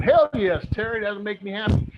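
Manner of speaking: voicing the crowd with a loud, high hell yes; excited audience. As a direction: hype it up for the viewers. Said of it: "I know you guys are going".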